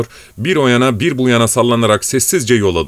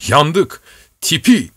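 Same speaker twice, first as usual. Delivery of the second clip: raised, urgent call over the storm wind; calling out in alarm; 6 semitones higher, slower than usual. A narrator's reading. Coachman warning his master of the blizzard, alarmed call. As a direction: cry out the danger, pitched well up. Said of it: "diye seslendi".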